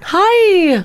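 phone voice. Shown as a demonstration. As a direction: sound happy for the caller. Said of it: "I do do that".

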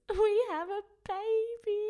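high, breaking with joyful tears; tearful joy. Retold or imitated. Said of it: "I was crying".

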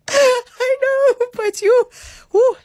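high, squeaky laugh through the words; real laughter. Real, not acted. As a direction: talk through a fit of giggles. Laughing after alienating American listeners.

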